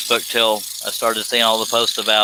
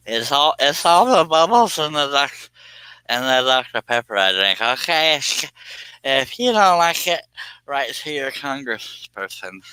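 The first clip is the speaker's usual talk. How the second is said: goofy voice; 5 semitones higher than usual. Pretend. Silly put-on voice joke.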